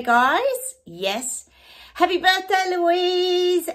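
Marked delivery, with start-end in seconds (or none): 1.9-3.7 higher-pitched celebratory call; cheery birthday cheer. Real, not acted. Vlogger wishing viewer Louise happy birthday, cheery. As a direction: sing out the birthday wish with joy.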